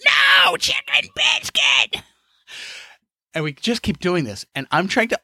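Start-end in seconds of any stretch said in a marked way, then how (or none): 0.0-2.0 put-on very high, slow, strained old-man cry; old man yell. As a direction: grandpa shouting over the drive-thru order. Grandpa yelling over the order.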